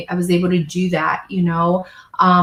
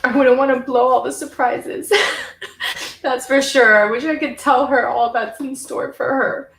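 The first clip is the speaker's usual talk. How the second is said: laughing through tears, higher; teary laugh; 5 semitones higher than usual. Real, not acted. Still tearful, she tells her younger self what's ahead. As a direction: smile through lingering tears.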